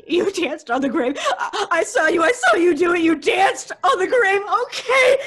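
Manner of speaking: laughing and crying at once; unhinged laugh-sob; hysterical laughter. Acted. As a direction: giggle hysterically through the words.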